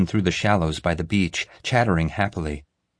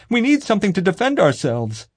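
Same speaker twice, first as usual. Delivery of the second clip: panicky wail; 13 semitones higher, 3 dB louder than usual. A narrator's reading. Nick panicking in gift shop.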